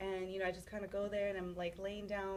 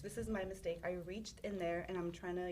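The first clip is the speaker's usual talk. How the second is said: ordinary talk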